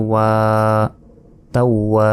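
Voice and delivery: ওয়া with its long a held about a second; তাওয়া, drawing out the last vowel; one-alif stretch. Shown as a demonstration. Teacher spelling for learners, one-alif stretch on ওয়া. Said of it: "এক আলিফ টান হবে".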